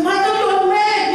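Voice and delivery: high scornful taunt; high jeering critic; mocking accusation. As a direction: call him crazy. Mocker in story.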